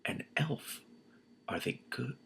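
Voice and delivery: whispered awe; hushed whisper. Real, not acted. Reader spotting the elf, whispering. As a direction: whisper as if not to scare it.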